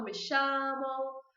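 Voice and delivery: Rioplatense Spanish with LL as "sh"; slow Argentine-accented Spanish examples. Shown as a demonstration. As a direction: slip into an Argentine accent, hushing each LL. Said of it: "quando eu tô na Argentina".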